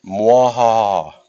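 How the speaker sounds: slow evil cackle; gleeful villain laugh, drawn out; mad scientist. Pretend. Evil laugh over ugly division.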